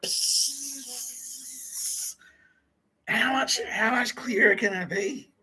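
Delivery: high, slow, exasperated mock plea. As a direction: act fed up and whiny. Mock exasperated and whiny voices.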